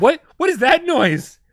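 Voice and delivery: high, loud, slow mock-horrified cry; drawn-out, high-pitched disbelief, played up; retold shock. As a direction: re-enact being startled by a strange noise. Acting out own shocked reaction.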